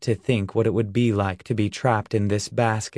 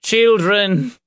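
high, loud impassioned call; 10 semitones higher, 6 dB louder than usual. A narrator's reading. Reverend appeals to the children, calling loudly. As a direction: call out urgently to them. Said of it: "the Reverend called".

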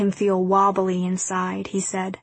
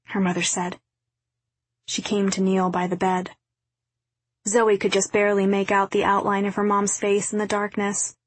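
plain reading voice

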